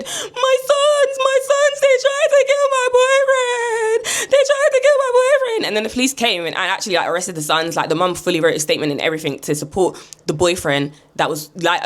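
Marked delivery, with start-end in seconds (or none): 0.0-5.7 very high, drawn-out wail; imitated distraught woman shrieking; mock hysterical mum. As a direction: wail it down the phone to police, hammed up. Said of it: "when she's called the police, she said".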